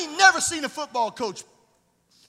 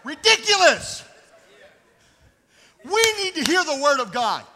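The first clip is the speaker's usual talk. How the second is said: ordinary talk, nothing marked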